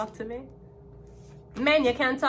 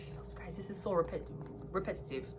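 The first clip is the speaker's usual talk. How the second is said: sighed, whispered exasperation; exasperated whisper; 4 semitones lower, 12 dB quieter than usual. Real, not acted. Reader fed up with repetitive cards, exasperated whisper. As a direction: whisper it wearily on a sigh. Exasperated at repeated card reading.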